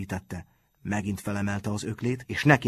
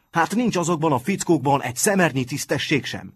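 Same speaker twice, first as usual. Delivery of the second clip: higher, indignant outburst; outraged cry; 6 semitones higher, 4 dB louder than usual. A narrator's reading. Martin beside himself at the boys' lack of honour, exclaiming. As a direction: cry out against their dishonour. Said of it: "kiáltotta magán kívül".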